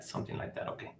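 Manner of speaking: breathy under-the-breath repeat; muttered whisper, almost no voice; whispered to self. Real, not acted. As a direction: whisper it to yourself.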